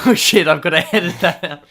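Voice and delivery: loud words through laughter; laughing. Real, not acted. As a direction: laugh while blurting it out. Laughing at slip-up.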